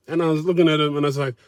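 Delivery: normal talking voice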